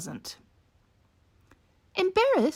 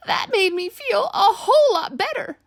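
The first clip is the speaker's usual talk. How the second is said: relieved orangutan; 9 semitones higher, 6 dB louder than usual. Acted. Orangutan relieved after hug.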